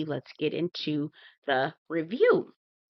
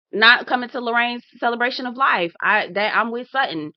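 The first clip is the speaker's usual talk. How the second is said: ordinary delivery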